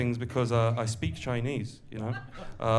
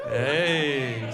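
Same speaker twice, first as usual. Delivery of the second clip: usual speaking voice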